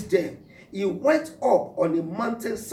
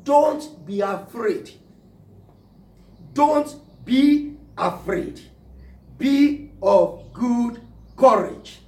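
slow, loud, higher, drawn-out command; 4 semitones higher, 6 dB louder, slower than usual. Acted. Jesus commanding his disciples, slow forceful preaching. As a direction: hammer every word home as an order. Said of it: "Jesus expressly commanding".

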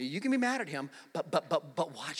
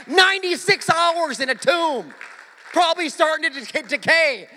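high, shouted preaching at its peak; triumphant preacher's roar; full-force preaching; 10 semitones higher, 13 dB louder than usual. Acted. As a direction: cry out the miracle to the congregation.

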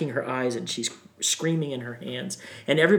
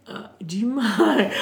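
nervous laugh; 10 semitones higher, 6 dB louder than usual. Real, not acted. Nervous ask before praying.

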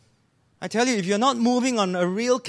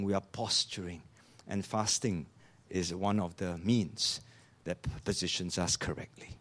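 much softer and lower, intimate close; hushed reflection; 11 semitones lower, 9 dB quieter than usual. Real, not acted. Preacher softly picturing eternity for the congregation, hushed. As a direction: draw them in with a hush.

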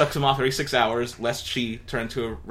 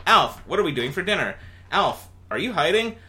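higher, louder calling around the house; 7 semitones higher, 3 dB louder than usual. Acted. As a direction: call him, wondering where he went. Calling for Alf.

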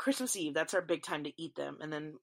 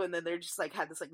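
ordinary talk, nothing marked

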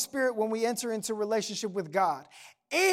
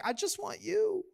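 high, soft mock whimper; imitated crying child; 7 semitones higher, 7 dB quieter than usual. Daughter runs back to dad in tears, imitated crying plea. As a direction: mimic a tearful little girl. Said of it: "screaming and crying".

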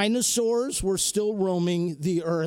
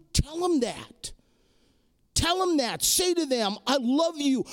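pitched high, urging the congregation; fervent sermon appeal, slowing at the end; impassioned preaching; 4 semitones higher than usual. Acted. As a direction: press the point home from the pulpit. Preaches the call to action.

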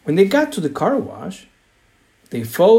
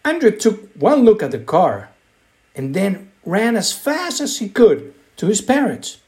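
usual speaking voice

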